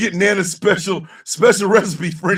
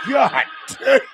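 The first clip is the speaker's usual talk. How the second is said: ordinary delivery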